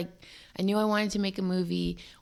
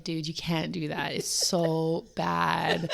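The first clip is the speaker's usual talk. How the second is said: mock disapproval; much slower than usual. Friends reacting to her Cockney attempt in rehearsal, mock disapproval. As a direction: imitate friends' disapproval, drawing out the last words.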